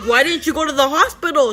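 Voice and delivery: high, loud questioning; retold incredulous scolding. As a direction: imitate a baffled paramedic demanding why. Paramedic scolds her, retold.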